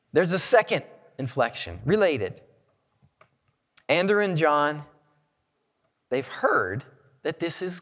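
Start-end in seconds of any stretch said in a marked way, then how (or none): none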